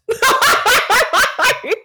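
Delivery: high-pitched burst of laughter; giggling high at a shared joke; real laugh. Real, not acted. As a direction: laugh in a run of high peals. Laughs at own curls joke.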